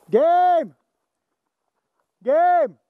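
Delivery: loud high drawn-out call; shouting over gunfire. Real, not acted. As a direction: call it across the whole field. Calling end of game.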